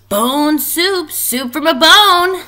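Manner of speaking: high, loud roar. A narrator's reading. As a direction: roar it out like a pack of monsters. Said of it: "they roared".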